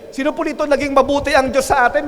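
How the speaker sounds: ordinary talk, nothing marked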